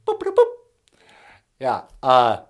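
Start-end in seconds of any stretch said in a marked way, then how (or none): 0.1-0.5 high electronic boops and beeps; computer beeps. Pretend. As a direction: beep like a talking machine. Computer beeping.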